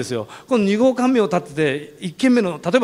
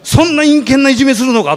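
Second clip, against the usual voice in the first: imitating an outraged policeman, much higher and louder; indignant cop; 8 semitones higher, 8 dB louder than usual. Policeman furious about the harassment, mock indignant shout. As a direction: blow up at the injustice. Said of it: "だんだんお巡りさんの方が興奮してきた".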